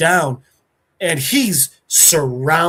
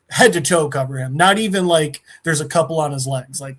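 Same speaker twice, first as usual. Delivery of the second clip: ordinary talk, nothing marked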